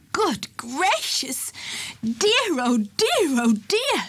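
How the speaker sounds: high, slow flustered gasp; a startled fluster, drawn out and high; flustered surprise. A narrator's reading. Warden flustered at finding an elephant, high and slow. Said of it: "who was really rather surprised".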